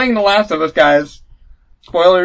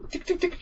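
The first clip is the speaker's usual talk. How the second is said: ticking sound; 14 semitones higher, 12 dB quieter than usual. Retold or imitated. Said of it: "you're like".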